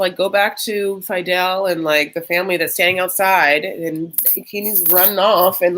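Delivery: usual speaking voice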